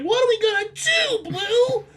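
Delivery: put-on very high panicky kid voice; scared kid begging Blue for help. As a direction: squeak it like a frightened child.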